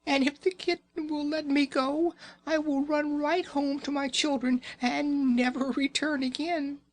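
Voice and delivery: very high, tiny squeaking mouse; squeaky mouse voice. Acted. Mamma mouse pleading with Raggedy Ann, very high squeak. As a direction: squeak it out like a frightened little mouse. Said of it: "the little mouse squeaked".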